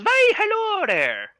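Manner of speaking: very high, slow gnome hello. Acted. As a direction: stretch the hello out like a tiny gnome. GM voices the gnome greeting.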